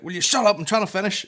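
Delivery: high, loud snap at the heckler; exasperated comic. Retold or imitated. Comedian snapping at the heckler to shut up, loud. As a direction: bark it like a fed-up comedian. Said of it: "was like".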